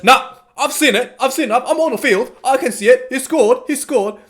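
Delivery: lightly mocked referee, pitched high and sure of himself; confident referee. Referee waves away French protests, mock high-pitched confidence. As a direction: brush off the protests with smug certainty.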